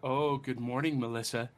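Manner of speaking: ordinary talk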